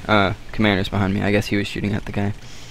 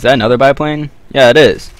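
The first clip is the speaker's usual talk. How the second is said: loud excited; 4 semitones higher, 7 dB louder than usual. Real, not acted. Spots enemy biplane.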